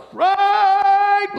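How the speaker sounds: very high, loud, stretched-out call; hollering. Retold or imitated. Hikers all call down to Franklin, hollering. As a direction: holler the name across a great distance. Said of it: "And we all hollered".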